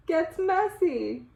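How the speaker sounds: high, slow gets messy in a different voice; slow, high, dramatic climax; dramatic reveal. A narrator's reading. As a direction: stretch out the punchline. Story climax.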